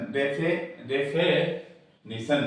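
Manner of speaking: डेफिनेशन slowly broken after "defe"; syllable-by-syllable डेफिनेशन with an e; weak vowel demonstration. Shown as a demonstration. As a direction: stretch "fe" in डेफिनेशन, then finish "nisan". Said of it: "की जगह हो जाएगा".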